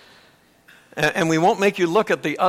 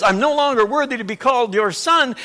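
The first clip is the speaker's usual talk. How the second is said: raised, louder son's plea; dramatic paraphrase; 5 semitones higher, 5 dB louder than usual. Retold or imitated. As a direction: rush the plea before being cut off. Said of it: "paraphrase this dramatically".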